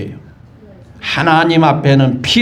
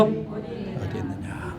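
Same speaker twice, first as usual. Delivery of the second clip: hushed, breathy whisper of God's call; 3 semitones higher, 18 dB quieter, much slower than usual. Acted. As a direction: drop to a slow, barely voiced whisper. God calls out to hiding Adam. (속삭이듯 느리게)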